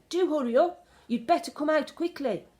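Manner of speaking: high, loud urgent call out the window; shouted call. A narrator's reading. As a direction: call her in, loud and pressing. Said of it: "she shouted".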